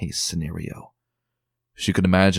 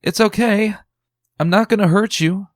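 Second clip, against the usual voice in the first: reassuring call; 13 semitones higher, 7 dB louder than usual. Acted. Reassuring a hidden frightened filly.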